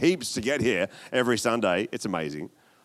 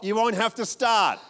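ordinary delivery

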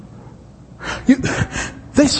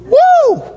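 high, loud church whoop; excited whoop; 4 dB louder than usual. Retold or imitated. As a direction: let out a cheering woo.

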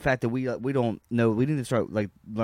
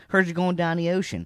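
put-on Baltimore accent; 6 semitones higher than usual. The other side greets the stranded American, Baltimore accent. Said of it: "Or the other side".

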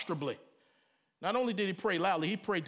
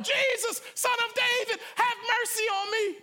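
much higher, louder desperate plea; crying out; 13 semitones higher, 4 dB louder than usual. A narrator's reading. Blind man by the road crying out to Jesus. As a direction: cry for mercy with all you have. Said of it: "he started crying".

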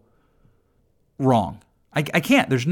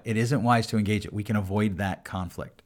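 inner thought; 8 semitones lower, 8 dB quieter than usual. Retold or imitated. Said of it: "In my head, I thought it".

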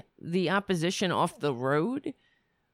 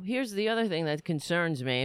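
normal talking voice

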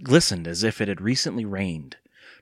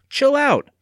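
high, raised-voice command; calling out; 5 dB louder than usual. A narrator's reading. Evan telling his barking dog to settle, calling out. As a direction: call it across the yard, high and loud. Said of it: "Evan called".

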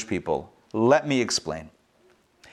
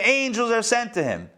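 ordinary talk, nothing marked

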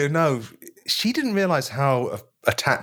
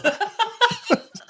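high squealing laugh. Real, not acted. Bursting out laughing.

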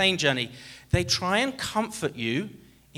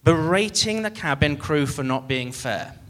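ordinary talk

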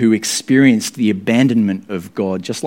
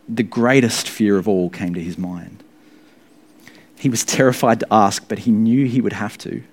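usual speaking voice

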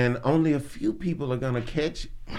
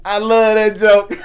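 normal talking voice

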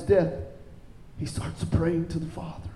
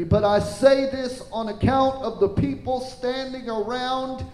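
higher, projected preacher's voice; raised, declarative Bible reading; scripture reading; 6 semitones higher, 3 dB louder than usual. Acted. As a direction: read the prayer out to a congregation. Reads scripture to congregation.

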